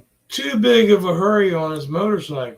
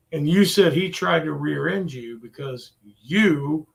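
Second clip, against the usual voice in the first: ordinary talk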